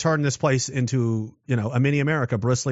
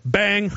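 a jokey bang from a pretend ambush; mock gunshot; 7 semitones higher than usual. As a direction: pop it out like a playful gunshot. Mimics shooting a soldier.

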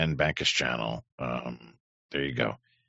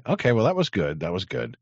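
ordinary delivery

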